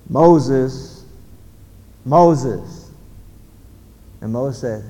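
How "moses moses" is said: loud, measured summons; commanding call from afar. Acted. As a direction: call the name out firmly, pausing between calls. God calls from burning bush.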